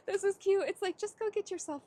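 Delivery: high, loud laughing burst of delight. Real, not acted. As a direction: laugh through it, charmed by the image. Delighted by her own vision.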